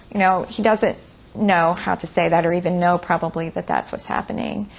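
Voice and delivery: usual speaking voice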